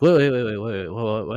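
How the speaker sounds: rapid-fire repeated protest; retold alarm. Patient as scissors reach his crotch, rapid alarmed protest. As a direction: imitate panicked stammering.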